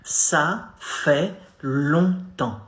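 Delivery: Ça fait longtemps stretched out slowly with nasal longtemps; slow teaching model; slow demo. Shown as a demonstration. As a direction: draw out Ça fait longtemps for the learner. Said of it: "Hey, salut".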